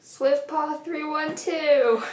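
slow, high, announcing her own name; announcer voice. Pretend. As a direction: proclaim the name slowly, like a show host. Cousin introduces herself playfully.

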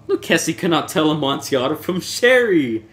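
put-on high, loud drunken scoff; mock the rival with tipsy contempt; scornful Fortunato.

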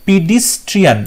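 "পিডিস্ট্রিয়ান" with ee sounds, "pee-dee-strian"; "পিডিস্ট্রিয়ান", first two vowels turned to i; misspelling read aloud. Shown as a demonstration. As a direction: say "পিডিস্ট্রিয়ান" as pee-dis-trian. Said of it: "এটাও ভুল হচ্ছে".

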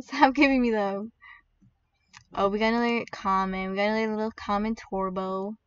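usual speaking voice

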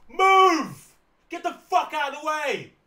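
high, drawn-out yell, then high and tighter; mock angry shout. Comic heckling slow-walking pensioner, mock angry shout.

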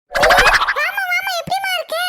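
high, loud greeting; loud call to come in; loud welcome. Acted. Greeting arriving sister aloud.

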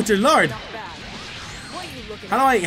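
ordinary talk

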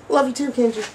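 very high squeaky cartoon bear; high squeaky puppet bear woken from sleep; sleepy teddy bear voice. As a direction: squeak like a groggy stuffed bear. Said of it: "It's Dab the AIDS Bear here".

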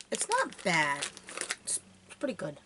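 chewing while talking; gummy candy in mouth; mouth full. Real, not acted. Seen 3: Seems to chew while talking, then bites another worm at 1.8s.

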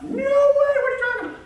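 very high, loud, fast outburst; hammed-up squeal of disbelief; mock incredulity. Acts out disbelief at coach's verdict.

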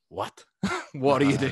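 half laughing at his own blunder; laughing at himself. Retold or imitated. Berating himself for missing the night, laughing.

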